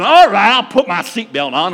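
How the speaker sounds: loud, grudging "All right"; a sulky driver snaps back at God, loudly; with an attitude. Retold or imitated. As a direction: give in loudly and resentfully. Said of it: "I did it with an attitude".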